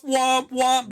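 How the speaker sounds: high whooping siren noise; imitated alarm. Host mimicking the breach anomaly alert, imitated alarm.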